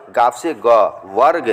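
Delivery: normal talking voice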